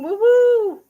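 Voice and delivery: high, loud cheer; a bright celebratory hoot; excited woo. Real, not acted. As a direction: whoop it up for the viewer. Cheering a viewer's thrift find.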